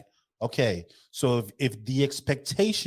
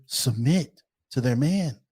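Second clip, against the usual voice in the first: normal talking voice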